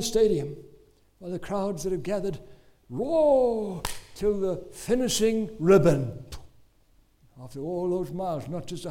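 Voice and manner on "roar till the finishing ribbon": big drawn-out roar, louder and slow; rousing storyteller at full voice; dramatized roar. Retold or imitated. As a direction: stretch ROAR out like a stadium crowd. Preacher dramatizing the crowd's roar.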